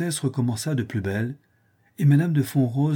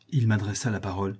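ordinary delivery, nothing marked

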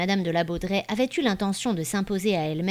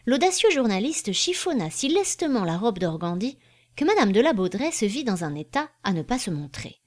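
usual speaking voice

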